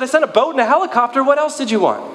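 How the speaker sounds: high, fast exasperated punchline; exasperated God. Retold or imitated. God answering the complaining man, quick and high. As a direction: deliver the punchline with dry exasperation. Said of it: "He said".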